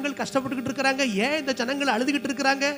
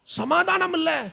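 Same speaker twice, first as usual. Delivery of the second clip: swooping, increasingly high exclamations; zealous preaching; 6 semitones higher than usual. Acted. Preacher voices Paul stirred by a city full of idols, mounting zeal. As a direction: build from indignant wonder to a stretched cry.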